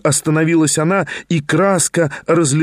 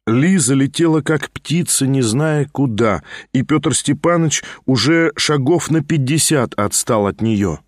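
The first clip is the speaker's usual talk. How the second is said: usual speaking voice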